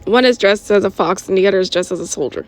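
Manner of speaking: ordinary delivery, nothing marked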